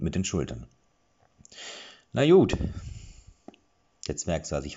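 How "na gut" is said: high, loud grudging dwarf voice; dwarf giving in. A narrator's reading. Dwarf accepts Killer's shrug, loud grudging voice. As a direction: shrug it off loudly.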